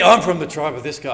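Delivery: loud teasing brag; mock pride. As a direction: boast about your leader. Voicing a proud follower.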